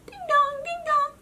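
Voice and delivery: high sung doorbell; doorbell chime. Acted. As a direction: sing the bell like a chime. Doorbell rings.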